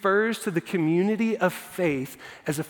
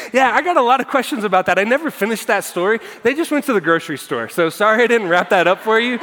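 playful rush; 4 semitones higher, 6 dB louder, faster than usual. Real, not acted. Joking self-deprecating aside, fast.

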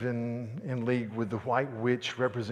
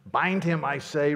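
commanding; 5 semitones higher, 8 dB louder than usual. A narrator's reading.

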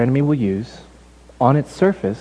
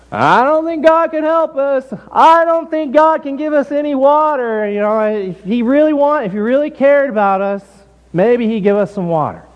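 meant to be overheard; 7 semitones higher than usual. Acted. Israelites hoping God overhears, high theatrical mock complaint. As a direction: project a staged lament for someone listening in. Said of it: "talking in such a way that they hope God will kinda, like, overhear it".

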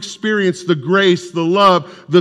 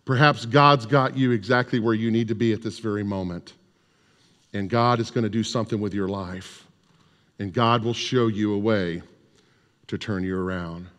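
much softer and lower, tender comfort; gentle reassurance; 6 semitones lower, 8 dB quieter than usual. Retold or imitated. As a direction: lay it on him quietly, like a promise. Chaplain consoling a ruined man.

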